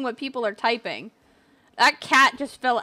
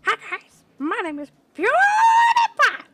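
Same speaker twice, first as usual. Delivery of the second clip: much higher, shouted YouTuber intro; PewDiePie impression; 10 semitones higher, 5 dB louder than usual. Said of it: "this guy's dressed as PewDiePie".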